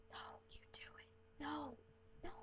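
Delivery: hushed, unvoiced whisper of a shy kid; urgent little whispered protest; whispered refusal. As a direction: whisper it so the waiter can't hear. Shy child refusing mom in public.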